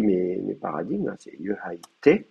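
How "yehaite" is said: yehaite said very slowly and carefully; teacher fixing a typo, saying yehaite slowly; corrected form. Shown as a demonstration. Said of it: "j'ai fait une faute".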